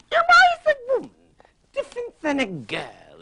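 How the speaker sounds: talk-singing leading man, bursting out high then slowing; Rex Harrison impression. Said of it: "I love Rex Harrison. He was one of the first rappers".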